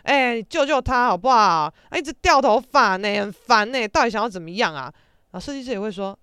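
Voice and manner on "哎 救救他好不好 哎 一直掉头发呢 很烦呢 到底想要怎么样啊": imitating the roommate's loud fed-up whine; whiny complaint. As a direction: moan to the stylist like it's a disaster. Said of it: "他也会跟熟的设计师朋友说".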